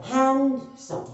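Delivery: Handsome slowly split as hand, then सम; broken into syllables. Shown as a demonstration. Teacher proving the break to students, slowly split. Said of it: "break हो रहा है".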